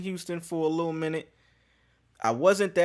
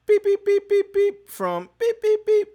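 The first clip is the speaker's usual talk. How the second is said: high, loud beeps standing in for the name; mock censor bleep; 6 dB louder than usual. Hiding the doctor's name from viewers, high mock bleeps.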